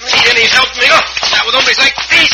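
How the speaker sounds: high, loud brawl yelling; fighting shout. Acted. Cisco brawling with bandits, shouting loud and high. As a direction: yell it over the scuffle.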